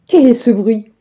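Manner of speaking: ordinary delivery, nothing marked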